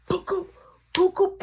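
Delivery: high punch-sound boops; imitated punch sounds. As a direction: voice the blows as high, quick boops. Acting out more punches.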